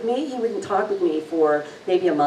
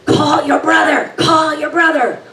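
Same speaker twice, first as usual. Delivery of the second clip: much higher, louder urgent repeated command; urgent shout; 9 semitones higher, 6 dB louder than usual. Retold or imitated. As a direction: yell it like it can't wait. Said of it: "his voice gets urgent".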